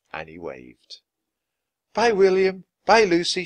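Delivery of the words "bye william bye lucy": high, loud goodbye call; shouted farewell. A narrator's reading. Annie to friends in a departing lifeboat, shouting. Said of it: "she shouted".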